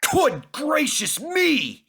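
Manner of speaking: stretched-out cry of amazement; surprised cry. A narrator's reading. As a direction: gasp it out in astonishment. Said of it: "he cried".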